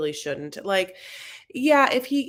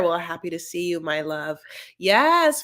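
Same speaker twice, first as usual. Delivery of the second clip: ordinary talk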